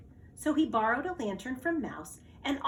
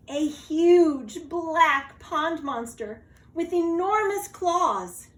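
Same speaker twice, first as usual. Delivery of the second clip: louder, slower, higher for the monster; dramatic reveal; 7 semitones higher, 9 dB louder, slower than usual. A narrator's reading. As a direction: build the fright, drawing it out. Narrator builds scary reveal.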